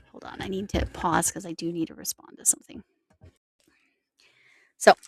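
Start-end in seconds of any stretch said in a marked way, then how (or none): none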